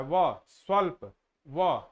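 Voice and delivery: each sound of व, स्वल्प drawn out at half speed; slow motion. Shown as a demonstration. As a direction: stretch व and स्वल्प out syllable by syllable. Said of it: "धीरे धीरे, slow motion में".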